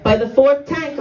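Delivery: ordinary talk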